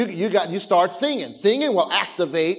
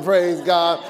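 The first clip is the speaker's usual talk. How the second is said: normal talking voice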